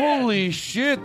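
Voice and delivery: high, drawn-out astonishment; amazed. Pretend. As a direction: stretch it out in awed disbelief. Gushing over a one-word accent.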